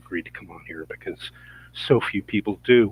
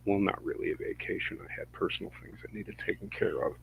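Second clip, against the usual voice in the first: muttered aside; 12 dB quieter, faster than usual. Real, not acted. Muttered aside about vacation.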